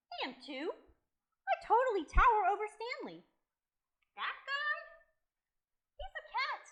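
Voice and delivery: put-on high, protesting little-boy voice; indignant boy. Boy insisting he towers over Stanley, high and indignant. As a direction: argue back, pitch climbing.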